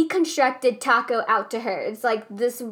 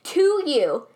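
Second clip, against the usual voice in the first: yelling; 5 semitones higher than usual. Real, not acted. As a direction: rant at full volume. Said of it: "Sorry, I'm yelling".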